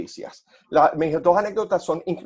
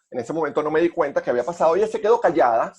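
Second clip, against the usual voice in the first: normal talking voice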